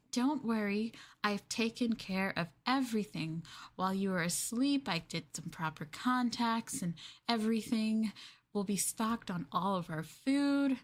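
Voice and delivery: normal talking voice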